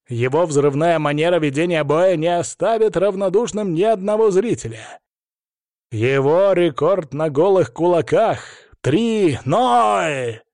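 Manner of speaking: high, drawn-out fight introduction; ring announcer. Acted. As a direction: build to a shouted, stretched-out fighter's name.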